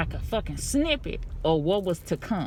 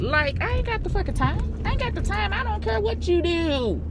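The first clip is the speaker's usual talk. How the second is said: exasperated rant; 10 semitones higher than usual. Real, not acted. Ranting to camera.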